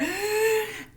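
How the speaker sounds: sudden startled intake of breath; sharp gasp. Retold or imitated. Parent walking into a messy room, sharp gasp. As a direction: gasp at the mess like a shocked parent.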